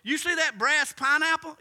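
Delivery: high, loud challenge; defiant witch. Retold or imitated. Witch daring the pastor, high and loud. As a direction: throw down a dare loudly.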